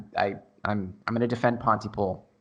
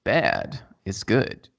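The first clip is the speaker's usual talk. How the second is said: ASMR voice; 3 semitones lower, slower than usual. Pretend. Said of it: "try your A S M R voice on the microphone".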